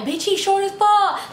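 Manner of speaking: high, drawn-out mock outcry; retold shocked exclamation. Friends clowning her about the short boy, shocked outcry. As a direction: exclaim it high, stretching the words.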